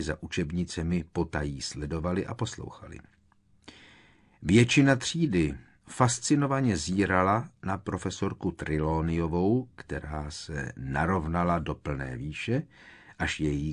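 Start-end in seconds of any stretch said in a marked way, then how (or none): none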